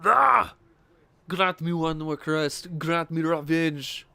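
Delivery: slow, drawn-out Arnold impression with an opening yell. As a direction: do an Arnold, stretching the words out slowly. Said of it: "like, an Arnold voice".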